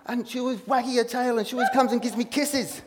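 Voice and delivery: doting dog owner, laughing; fond gushing. Real, not acted. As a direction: gush about the dog. Gushing about dog's love.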